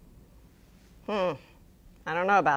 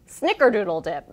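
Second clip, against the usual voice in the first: normal talking voice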